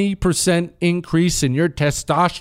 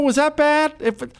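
ordinary talk, nothing marked

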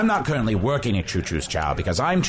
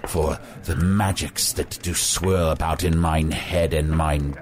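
low, slow archaic wizard oration; grandiose wizard; 7 semitones lower, slower than usual. Acted. Wizard declaiming on his terrible dreams to the host, grandiose. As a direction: intone it slowly and low like an old-world sorcerer.

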